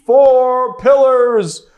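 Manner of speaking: loud, high, drawn-out proclamation; big announcer voice. Pretend. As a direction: belt it out slowly like a grand announcement. Hyped-up announcement of channel catchphrase.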